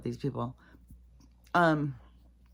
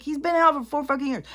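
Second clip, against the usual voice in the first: angry outburst; 12 semitones higher, 7 dB louder than usual. Real, not acted. Angry retort.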